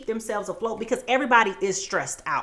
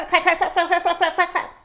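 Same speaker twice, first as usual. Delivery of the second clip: chanted clapping; 6 semitones higher, 6 dB louder than usual. Pretend. Imitating the audience clapping.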